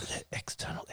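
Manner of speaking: whispered, ashamed answer; mortified whisper. Retold or imitated. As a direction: barely breathe the words out. Answering the pharmacist in front of everyone.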